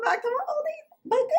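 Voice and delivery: high, giggling welcome; laughing. Real, not acted. Welcoming viewers back, laughing high. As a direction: laugh through the words.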